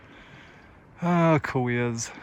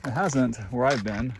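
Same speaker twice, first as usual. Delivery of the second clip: ordinary talk, nothing marked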